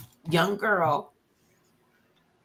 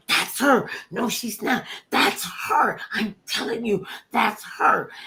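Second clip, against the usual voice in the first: high-pitched squabble; insistent child bickering; 5 semitones higher than usual. Retold or imitated. Twins debating a celebrity sighting, insistent. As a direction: argue back and forth, higher and insistent.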